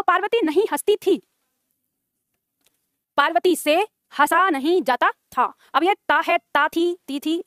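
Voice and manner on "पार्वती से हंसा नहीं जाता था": slow, word by word for writing down; slow dictation. Shown as a demonstration. Teacher dictating the answer sentence, slowly. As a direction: dictate it slowly so students can write. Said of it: "तो दूसरा बताओ".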